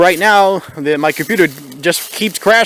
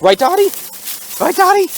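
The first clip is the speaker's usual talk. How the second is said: much higher, teasing; high playful question; 13 semitones higher than usual. Acted. Asking Dottie to join in, high playful tone. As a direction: pitch it way up as if coaxing. Asks Dottie, rising pitch.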